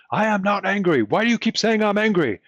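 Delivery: loud, high-pitched angry denial; angry yelling. Acted. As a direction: yell it, indignant and oblivious to your own anger. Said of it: "except maybe for the sound of their voice. It's like, "Oh, I'm yelling".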